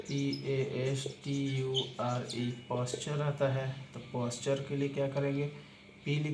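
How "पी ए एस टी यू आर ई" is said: letters spelled out one by one, very slowly; slow letter-by-letter dictation; slow spelling out. Shown as a demonstration. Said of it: "यहीं पर posture आता है".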